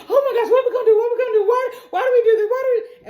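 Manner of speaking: high, loud frantic babble; hammed-up panic, words tumbling out; acted freak-out. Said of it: "Two-Face is freaking out".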